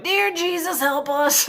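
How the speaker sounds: high, pleading mock prayer to Jesus; praying aloud. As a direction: imitate a frantic plea in a diving plane. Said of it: "I was praying audibly".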